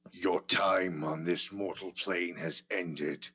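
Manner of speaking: low, guttural and otherworldly; guttural voice with a ghostly, ethereal edge. Acted. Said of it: "weird combination of guttural meets ethereal voice".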